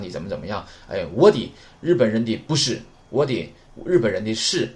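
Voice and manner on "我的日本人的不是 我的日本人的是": lightly imitated film-Japanese accent, punched louder; mock Japanese-soldier Chinese. Teacher quoting war dramas to show word order, mock Japanese accent. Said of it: "是不是老这样说话呀".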